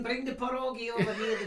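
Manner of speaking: put-on Polish accent. Imitating Polish parent calling out.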